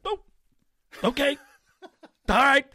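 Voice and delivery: high, loud relieved okay with a laugh; relieved surprise. Retold or imitated. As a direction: burst out in happy relief. Relieved reaction to small price.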